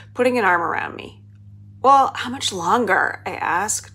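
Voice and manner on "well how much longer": put-on slow, higher, louder impatient kid; suppressed whine. A narrator's reading. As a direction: drag it out with a touch of whine. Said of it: "trying not to whine".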